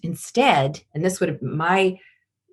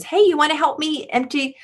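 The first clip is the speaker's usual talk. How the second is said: much higher, bright, asking question; sweet asking mom; 9 semitones higher than usual. Shown as a demonstration. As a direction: lift it high and hopeful like a request.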